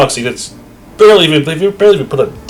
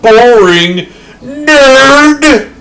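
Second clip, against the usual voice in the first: drawn-out, much higher, louder taunt; slow, loud sing-song jeer at the nerd; mock taunt; 13 semitones higher, 9 dB louder, much slower than usual. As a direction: stretch out the insult like a playground jeer. Mocks game character loudly.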